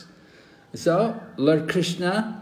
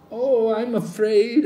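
mock fearful; 8 semitones higher, 6 dB louder than usual. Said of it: "And I said".

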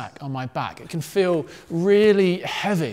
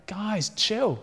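a touch higher, easygoing reassurance; lightly voiced Jesus; 4 semitones higher than usual. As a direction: lightly act out a laid-back calming of friends. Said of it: "But Jesus was like".

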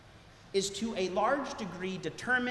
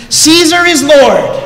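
loud, higher, drawn-out hail; mock public proclamation; 5 semitones higher, 24 dB louder, slower than usual. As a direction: proclaim it slowly like a citizen's salute. Acted Roman public greeting.

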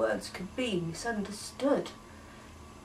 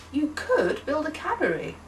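gentle suggestion; 7 semitones higher than usual. Acted. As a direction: offer it kindly as a maybe. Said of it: "they suggested their alternate plans".

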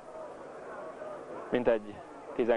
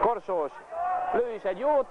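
excited commentary; 9 semitones higher than usual. Acted. Commentator calling a shot.

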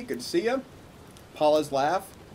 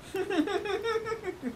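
laughing. Real, not acted. Reacts to the call clip.

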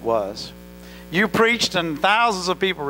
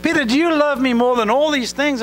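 much higher and louder, pleading insistence; Jesus pressing Peter a third time, raised and urgent; urgent third asking; 10 semitones higher, 6 dB louder than usual. Retold or imitated. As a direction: press him hard the third time, voice climbing.

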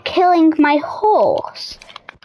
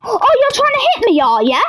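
fast, much higher mock outrage; 8 semitones higher, faster than usual. Taunting Toby for trying to hit him, fast high mock. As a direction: squeak it fast like a mocking taunt. Mocking Toby's attempt to hit.

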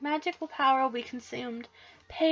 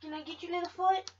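normal talking voice

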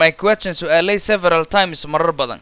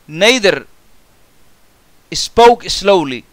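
slow model reading; 2 semitones higher, 5 dB louder, much slower than usual. Shown as a demonstration. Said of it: "teacher models sentence slowly".

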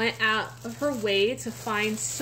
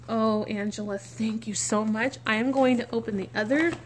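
ordinary delivery

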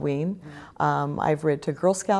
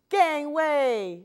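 much higher playful pirate yell; 10 semitones higher than usual. Acted. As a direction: shout it as the kids rush to the kitchen. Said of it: "yell her little pirates".

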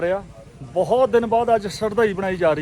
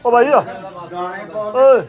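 calling out; 2 semitones higher, much slower than usual. Real, not acted. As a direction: shout to get his attention. Calling out to a friend.

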